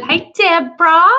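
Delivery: high, loud excited greeting; calling out. Real, not acted. Vlogger greets her hairdresser friend mid-livestream, calling out. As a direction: call out brightly to a friend joining.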